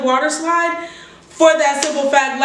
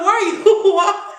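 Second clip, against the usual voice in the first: mom; 5 semitones higher, faster than usual. Retold or imitated. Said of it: "My mom was like".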